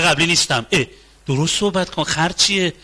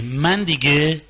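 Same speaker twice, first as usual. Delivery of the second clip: ordinary delivery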